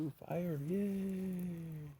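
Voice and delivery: high, excited squeal of delight; real high-pitched whoop of excitement; delighted cheer. Real, not acted. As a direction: cheer it, stretching out the yay. Delighted by fire at sign-off.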